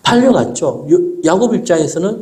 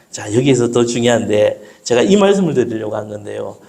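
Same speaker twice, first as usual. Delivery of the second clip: ordinary talk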